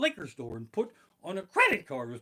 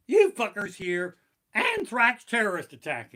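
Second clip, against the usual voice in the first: mock alarm; 5 semitones higher, 8 dB louder than usual. Mocking panicked shoppers.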